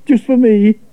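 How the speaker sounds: lightly voiced boy calling to the whale; Maurice, eager angler. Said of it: "cried Maurice".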